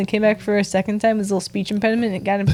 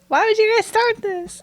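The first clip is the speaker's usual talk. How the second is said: put-on older child voice; 12 semitones higher than usual. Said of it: "Such a dramatic eight-year-old".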